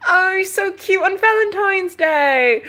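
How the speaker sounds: high, drawn-out gushing coo; exaggerated cooing. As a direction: melt over something adorable, high and slow. Cooing at squirrels mating.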